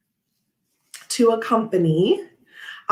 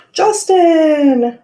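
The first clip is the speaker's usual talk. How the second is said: loud, high call across the house; shouting a name to another room; 9 semitones higher, 7 dB louder than usual. Real, not acted. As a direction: call him over excitedly. Calling husband for fashion show.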